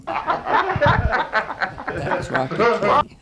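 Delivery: slow, high, laughing through it. Real, not acted. Teller laughing while crediting friend's joke. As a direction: giggle out the name.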